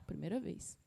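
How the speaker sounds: ordinary talk, nothing marked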